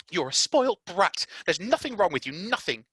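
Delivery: put-on Mary scolding the spoilt boy. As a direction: tell him off coldly.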